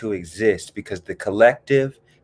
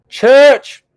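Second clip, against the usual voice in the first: high, loud church call-out; exuberant shout; 11 dB louder than usual. Acted. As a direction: shout it out like a fired-up congregation. Exuberant church call-out after "I love church.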